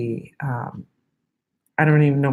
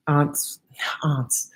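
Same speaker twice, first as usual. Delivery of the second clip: whispering; 4 dB quieter than usual. Real, not acted. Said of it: "I have to be quiet".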